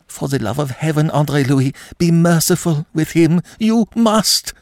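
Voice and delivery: high, urgent begging; desperate plea. A narrator's reading. Woman begs André Louis to be merciful, desperate. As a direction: plead in a high, distressed woman's voice. Woman clutches his arm, pleading.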